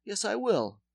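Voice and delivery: lecturing in mock Polish accent. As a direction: dentist explaining tooth roots in accent. Dentist voiced.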